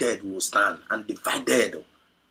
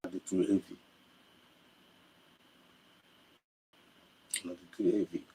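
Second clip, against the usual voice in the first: emotional, near tears. Real, not acted. Speaker overwhelmed by the message, voice breaking and fading. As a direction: struggle to hold back tears.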